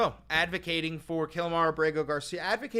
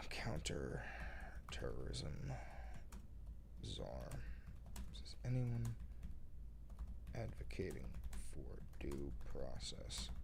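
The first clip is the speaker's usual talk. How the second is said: murmuring to oneself; 14 dB quieter than usual. Real, not acted. Streamer talking to self while pulling up a story, murmuring. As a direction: half-whisper the words one by one, with long gaps.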